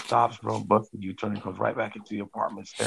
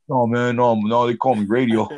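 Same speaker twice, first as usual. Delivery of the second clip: high, loud, panicky denial; scared denial; 3 semitones higher, 9 dB louder than usual. Retold or imitated. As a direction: hurriedly insist you're someone else, loud. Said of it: "he's like".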